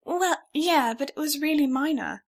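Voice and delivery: lightly voiced young heroine, shrugging it off; the stranger waves away her sprained ankle; sheepish protest. A narrator's reading. Heroine brushing it off.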